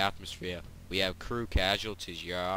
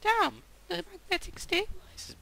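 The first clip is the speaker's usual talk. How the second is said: put-on "Damn, the magnetic stabilizer's" in a much higher girl's voice. Shown as a demonstration. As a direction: pitch way up and play the girl character. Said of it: "We need to talk in a girl accent".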